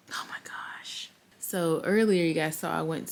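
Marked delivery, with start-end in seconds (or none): 0.1-1.1 hushed whisper with hiccups. Real, not acted. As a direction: whisper under your breath. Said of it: "I hope I don't have the hiccups".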